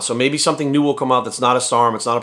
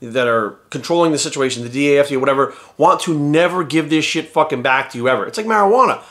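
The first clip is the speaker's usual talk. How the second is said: ordinary talk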